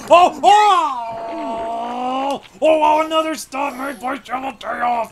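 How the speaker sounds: high, loud yelps into the call; excited cries. Real, not acted. Gamer crying out at another big save, high and loud. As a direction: cry out at the save.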